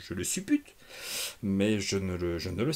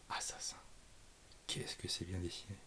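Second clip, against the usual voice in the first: chuchoté admiratif; 3 semitones lower, 14 dB quieter than usual. Real, not acted. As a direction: tout bas, émerveillé. Admiration devant le dessin. (chuchotement ébahi devant le dessin)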